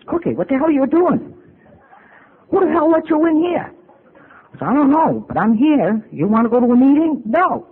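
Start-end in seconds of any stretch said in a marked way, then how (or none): 0.1-3.7 a high, loud, startled yell. Retold or imitated. Friend yelling at the speaker who walked into his room, startled loud yell. Friend yells at surprise visitor. 4.7-7.7 high, loud yell matching his tone; yelling right back. Retold or imitated. Speaker answering her friend's yell in kind, loud and high. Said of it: "I talked to him the same way he talked to me".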